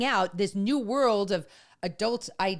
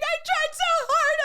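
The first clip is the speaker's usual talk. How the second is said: much higher, wailing mock sob; crying out; 5 dB louder than usual. Mom breaking down in front of her son, mock sobbing wail. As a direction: wail it like you are breaking down over a ruined effort. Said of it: "like, crying".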